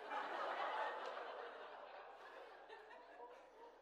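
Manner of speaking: long breathy laugh at his own punchline; hearty laugh. Real, not acted. Punchline of the Riptide story.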